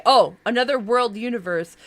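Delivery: ordinary delivery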